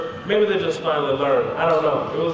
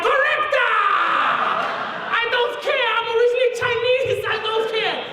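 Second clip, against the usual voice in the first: put-on very high, jubilant Chinese-accented shriek; hammed-up Chinese examiner, thrilled; thick Chinese accent. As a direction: cry out in an exaggerated Chinese accent. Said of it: "she had a really thick Chinese accent".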